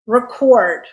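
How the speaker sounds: "record" stressed on the second syllable, re-CORD. Shown as a demonstration. Teacher introduces the verb, "record" stressed on CORD. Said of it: "it is a verb".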